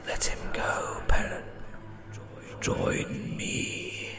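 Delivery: soft, unvoiced tempting whisper; ghost voice. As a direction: lure him in, barely breathed.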